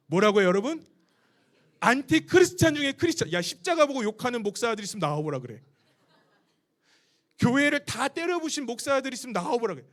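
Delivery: full-force sermon voice; preaching at full force. Acted. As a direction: challenge the whole room. Fired-up preaching to the crowd.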